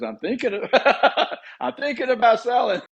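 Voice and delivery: high, laughing seller voice. Pretend. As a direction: giggle it out high. Acted seller with buyers rushing.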